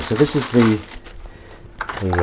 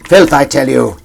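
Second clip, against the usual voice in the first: hammed-up old-timer; 3 semitones higher, 7 dB louder than usual. Mock-theatrical disgust at kitchen.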